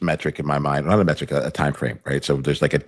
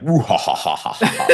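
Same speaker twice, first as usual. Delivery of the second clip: high, hammed-up evil cackle; villainous laugh; 5 semitones higher than usual. Shown as a demonstration. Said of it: "a villainous laugh".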